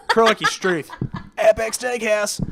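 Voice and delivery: half-laughed hammed-up Australian accent; joke Australian accent. Host teasing cohosts with a laughing fake Australian accent. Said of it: "Could talk in my Australian accent".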